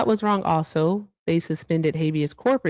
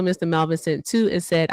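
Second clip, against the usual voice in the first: ordinary delivery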